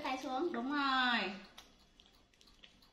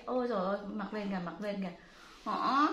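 ordinary talk, nothing marked

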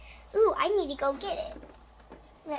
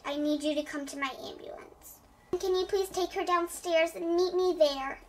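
ordinary delivery

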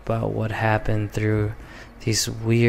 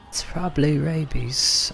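put-on English accent try; 5 semitones higher than usual. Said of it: "practice my entire terrible English accent".

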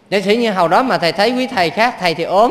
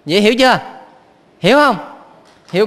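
ordinary delivery

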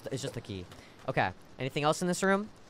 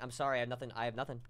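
mock sad; 8 semitones lower, 14 dB quieter, much faster than usual. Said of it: "I'm kidding".

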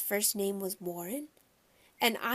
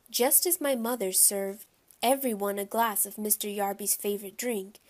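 ordinary delivery

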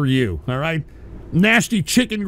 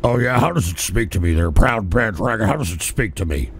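much lower, taunting; deep mocking persona; 10 semitones lower than usual. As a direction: needle the caller in a deep put-on voice.

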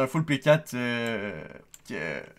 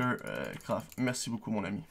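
normal talking voice

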